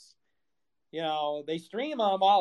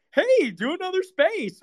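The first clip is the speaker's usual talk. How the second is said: much higher, laughing mock voice; mock fan request; 13 semitones higher, 5 dB louder than usual. Streamer mocking fans asking for spaces, high laughing. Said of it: "That's when you ask me".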